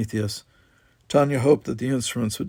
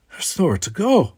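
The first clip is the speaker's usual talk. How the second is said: higher, despairing tearful voice; sobbing; 8 semitones higher, 4 dB louder than usual. A narrator's reading. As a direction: sob it out hopelessly. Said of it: "he was sobbing".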